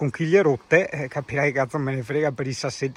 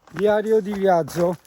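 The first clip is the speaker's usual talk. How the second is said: mock travel diary; 5 semitones higher than usual. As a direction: announce it like a nature documentary. Mock documentary narration. (voce da documentario)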